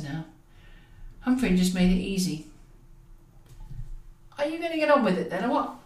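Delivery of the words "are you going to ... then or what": high, fast, chirpy taunt; breezily cheeky, eager to get on; cheerful. Acted. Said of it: "Why did the damn boy sound so bloody cheerful?".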